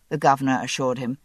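ordinary delivery

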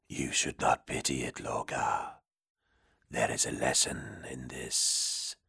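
a cold ancient demon murmuring low. Acted. Demon warns Lorgar.

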